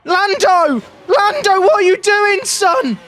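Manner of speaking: high, loud panicked yell at the driver; panicked shout. Real, not acted. Streamer yelling at his driver who botched the start, panicked. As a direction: yell at him in disbelief.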